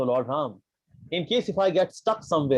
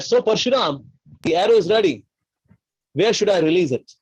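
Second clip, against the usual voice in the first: loud, raised, commanding voice; forceful acted demand; bold challenge; 3 semitones higher, 5 dB louder than usual. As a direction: call out firmly with the arrow drawn. Acted Ram challenges Parshuram, arrow drawn.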